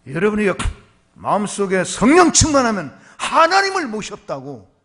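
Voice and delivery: loud, rising pulpit delivery; fervent preaching. Acted. Pastor exhorting the congregation to be Spirit-filled, fervent preaching. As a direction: preach it with fire.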